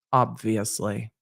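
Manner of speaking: slow, low, disdainful drawl; Snape-like disdain. Retold or imitated. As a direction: sneer it like a bored professor. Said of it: "she sounded exactly like Snape".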